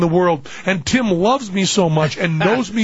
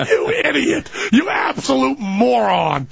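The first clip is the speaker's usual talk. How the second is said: mock scolding; 7 semitones higher than usual. Tim slapping his friend, laughing mock rebuke. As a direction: scold him through a laugh. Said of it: "slap me right across the face".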